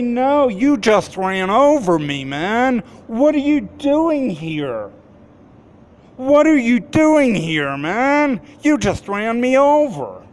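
high, loud, indignant retold protest; retold upset protest. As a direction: relive the shock at the driver who hit him. Retelling being run over, confronting driver.

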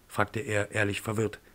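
ordinary delivery, nothing marked

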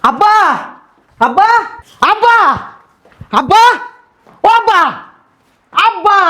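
high, rising yells for Dad; yelling for father. Acted. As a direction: call out over and over, each cry pitched high. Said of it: "চিল্লাইতেছস কে?".

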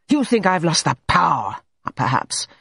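angrily. A narrator's reading. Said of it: "he said angrily".

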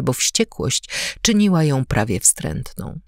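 plain reading voice

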